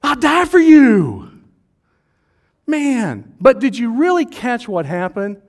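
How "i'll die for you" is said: high, loud, slow boast; teen bravado. Retold or imitated. Peter boasting to Jesus, high, loud, drawn out. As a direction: swear it like a cocky teen. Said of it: "sixteen-year-old boy that".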